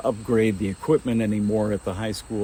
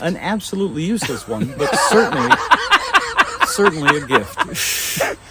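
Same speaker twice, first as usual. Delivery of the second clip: laughing; 7 semitones higher, 8 dB louder than usual. Real, not acted. Laughing at own useless gift.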